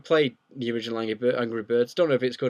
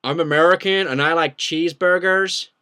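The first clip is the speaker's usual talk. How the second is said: loud, raised-pitch caricature American; attempted American accent; 5 semitones higher, 8 dB louder than usual. Shown as a demonstration. Brit has another go at American for viewers, loud mock American accent. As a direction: proclaim it in a hammed-up American accent. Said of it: "do your best American accent".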